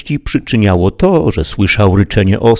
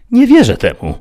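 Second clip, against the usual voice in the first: much higher squeaky protest; Sancho disbelieving; 6 dB louder than usual. Acted. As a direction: refuse to believe your master. Sancho doubts master.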